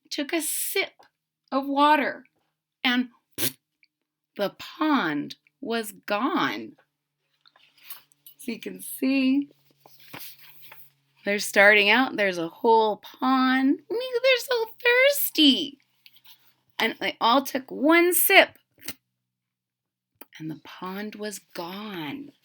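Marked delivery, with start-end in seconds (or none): 3.4-6.8 slow, soft reveal; dramatic reveal. A narrator's reading. 13.9-15.8 high squeaky kitten voice. Acted. Kitten voice repeated. 20.4-22.3 soft, slow hushed reveal. A narrator's reading.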